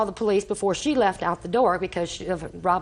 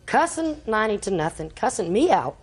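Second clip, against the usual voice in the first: ordinary talk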